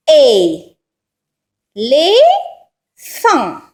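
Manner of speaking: Et, Les, fan, each drawn out alone with a pause; syllable by syllable. Shown as a demonstration. Teacher asking for each syllable of éléphant, syllable by syllable. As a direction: sound out éléphant in three pieces. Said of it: "La première syllabe".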